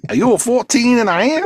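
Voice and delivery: high, slow southern drawl; laughing Southern salesman; mock tailor voice.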